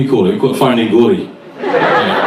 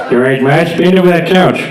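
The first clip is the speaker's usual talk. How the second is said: cartoon husband voice; Homer Simpson. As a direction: play the eager cartoon dad. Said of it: "Homer comes back to the house".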